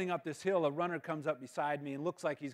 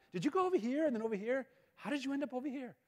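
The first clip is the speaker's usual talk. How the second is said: lightly imitated coach, higher-pitched puzzled questions; incredulous questioning; 7 semitones higher than usual. Coach doubting the runner took the correct route, incredulous. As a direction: question it in mild disbelief, pitched up. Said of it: "even asking me if I ran".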